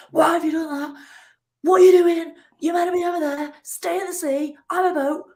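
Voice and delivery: high, loud hammed-up tantrum yell; mock angry shout at the sea. Rower yelling at the sea in a high mock tantrum. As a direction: rant at the waves like a wronged child. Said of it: "shouting every sort of angry thing".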